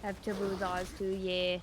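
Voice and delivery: ordinary delivery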